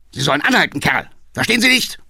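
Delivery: high, loud enraged yelling; furious shout. Acted. Councillor furiously orders the coachman to stop. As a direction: scream at him in a rage. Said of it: "schrie der Alte kirschrot vor Wut".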